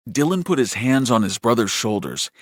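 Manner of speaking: plain reading voice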